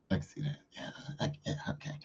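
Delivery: trailing into a whisper; mumbling to himself. Acted. Boy muttering to himself at the closet, fading to a whisper. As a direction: fade off as you talk yourself into it.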